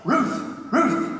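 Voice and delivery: words barked like a dog; high woofed answer, two short barks; talking dog. Acted. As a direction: bark the word out as a dog. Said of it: "And Rover says".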